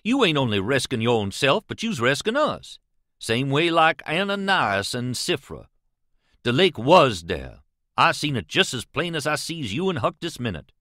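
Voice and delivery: ordinary delivery, nothing marked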